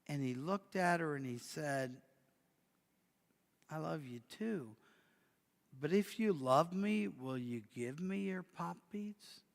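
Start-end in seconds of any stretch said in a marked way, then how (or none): 3.7-9.4 softer, tender question; a father quietly testing his daughter's love; father softly asks his little girl for her beads; gentle father. Acted.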